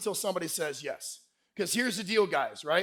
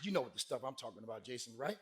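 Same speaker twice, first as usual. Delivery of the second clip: low, hushed, quick imitation; quiet confiding flirt; soft flirty aside; 9 semitones lower, 16 dB quieter, faster than usual. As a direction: say it quietly and fast.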